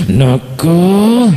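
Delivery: "nakal" stretched slowly with rising, heated tone; angry drawn-out. Shown as a demonstration. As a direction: drag out "nakal" like an angry parent. ("nakal" dipanjangkan dengan nada emosi)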